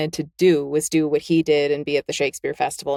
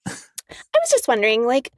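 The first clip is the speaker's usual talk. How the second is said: high, hesitant ditzy-student imitation; American girl questioner; 10 semitones higher than usual. American girl questioning Philip Pullman after a lecture, mock-hesitant. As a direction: mimic an eager student asking a naive question, pitched high. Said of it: "American. It's always the Americans".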